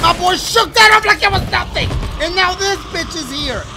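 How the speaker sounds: alarmed excitement. Real, not acted. Monster shrugs off the attack.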